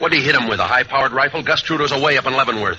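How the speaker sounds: mock the cop's theory; sarcastic retort. Bandleader mocking the detective's suspect, loud and sarcastic.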